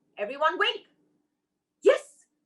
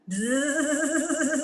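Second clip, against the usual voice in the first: long, wavering bee buzz; 2 semitones higher than usual. Acted. Beehive let out of the sack, buzzing angrily. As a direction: sustain a pulsing buzz like a swarm. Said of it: "Beehive started buzzing".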